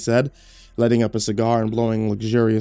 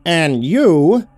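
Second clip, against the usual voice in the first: much higher, louder, outraged; furious rant; 9 semitones higher, 5 dB louder than usual. Acted. A demoted reporter rants at his boss, outraged. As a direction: vent the whole list in disbelief.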